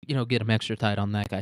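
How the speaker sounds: ordinary talk, nothing marked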